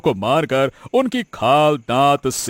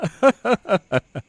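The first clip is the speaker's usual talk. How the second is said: gloating laugh; 7 semitones higher than usual. Acted. Villain gloats.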